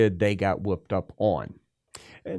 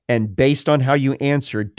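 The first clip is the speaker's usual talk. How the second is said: ordinary talk, nothing marked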